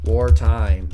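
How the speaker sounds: a loud booming call; loud announcement. Real, not acted. Streamer announcing the war to chat, loud call. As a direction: boom it out like a battle cry.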